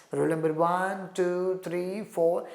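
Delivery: "one", "two", "three", "four" each lifted at the end like a roll call; rising tone on each. Shown as a demonstration. Teacher modelling enumeration intonation, a rising tone on each number. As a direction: call the numbers with a rise on every word. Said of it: "On every word, you can find rising tone".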